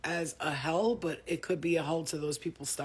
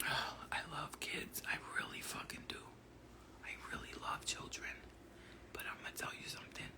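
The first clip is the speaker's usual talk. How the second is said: whisper; 11 dB quieter than usual. Real, not acted. Whispering, tired, kids asleep.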